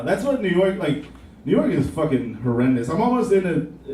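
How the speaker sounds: ordinary talk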